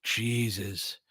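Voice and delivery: usual speaking voice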